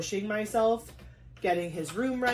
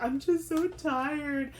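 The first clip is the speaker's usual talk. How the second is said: whiny tired; 7 semitones higher, much slower than usual. Retold or imitated. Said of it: "And I was like".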